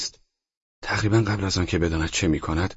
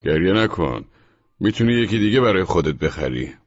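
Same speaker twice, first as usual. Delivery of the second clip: sarcastic; 4 semitones higher, 4 dB louder than usual. Acted. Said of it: "با لحن کنایه آمیزی گفت".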